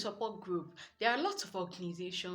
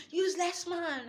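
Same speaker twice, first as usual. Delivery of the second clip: mock yell, higher and louder; shouting back; 10 semitones higher, 6 dB louder than usual. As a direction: fling the insult back at him. Wife shouts the insult back.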